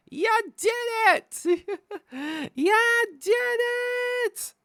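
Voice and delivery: very high, slow, drawn-out cheer. Acted. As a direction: squeal it like a cartoon cheerleader. Cheering the viewers.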